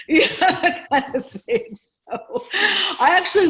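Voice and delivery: real laughter through the words; laughing. Real, not acted. To the host, about her classroom pranks, laughing.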